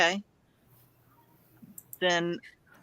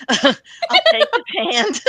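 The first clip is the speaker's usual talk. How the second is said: real laugh breaking through, higher and louder; 12 semitones higher, 7 dB louder, slower than usual. Real, not acted. Player choosing to help the fallen man, laughing. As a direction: laugh your way through the words. Laughs while deciding to take hand.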